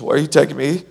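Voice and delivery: high, loud frightened ask; panicked question. Retold or imitated. Charlie frightened as they near his exit. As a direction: voice jumping in alarm. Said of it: "He says".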